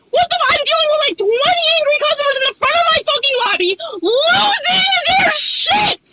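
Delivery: shouting back at the server who keeps nagging; furious shout. Pretend. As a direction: yell it like you've had enough.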